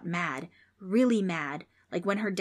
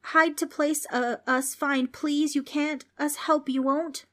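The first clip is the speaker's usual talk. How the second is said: high-pitched, halting jumbled plea; begging; 8 semitones higher, slower than usual. A narrator's reading. Frank asks ghost for hiding place, begging. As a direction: plead desperately in broken bursts. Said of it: "Frank begged".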